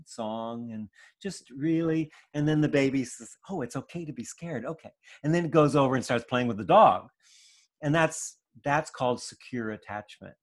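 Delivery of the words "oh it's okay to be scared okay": put-on quick, soft, relieved child's voice; relieved little child. Calmed toddler repeating mommy's words, quick and relieved. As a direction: repeat it like a toddler who just got it.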